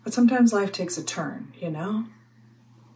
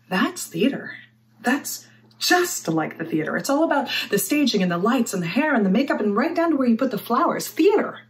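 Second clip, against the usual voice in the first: swooping higher, building to a peak on the last word; theatrical enthusiasm; 4 semitones higher than usual. Acted. Mortician likening a funeral to theater, excited. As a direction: list it with mounting stage-struck delight.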